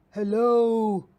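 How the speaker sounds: loud booming hello; booming giant. Acted. The giant booms his hello at the gnomes. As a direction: boom it down at the tiny gnomes.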